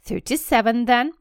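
Dudley grudgingly recounting, face going red; sulky child. Acted. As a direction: give in with a pout, getting angry. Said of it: "said Dudley, going red in the face".

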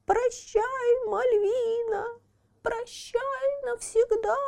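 high squeaky wailing farewell; wailing boo hoo crying. Acted. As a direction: squeak it out in tears, drawn out. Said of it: "плаксивым голосом пропищал человечек". (плаксивый писк Пьеро)

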